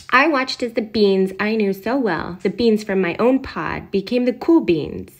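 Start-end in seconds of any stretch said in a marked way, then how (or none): none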